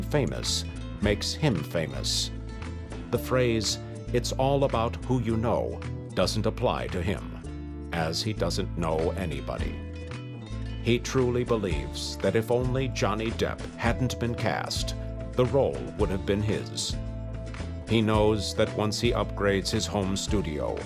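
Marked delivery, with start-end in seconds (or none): none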